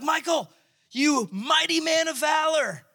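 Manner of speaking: high, booming heavenly announcement; grand angel greeting. Pretend. Angel hailing hiding Gideon, a high proclaiming call. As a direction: hail him like a proud herald. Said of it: "and he's like".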